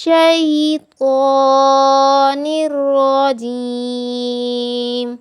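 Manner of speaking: slow, melodic tajweed recitation; Quran recitation. Shown as a demonstration. Teacher recites for her students with slow melodic tajweed.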